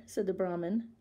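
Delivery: ordinary delivery, nothing marked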